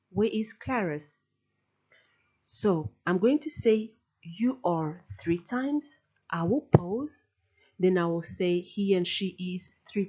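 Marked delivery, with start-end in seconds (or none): none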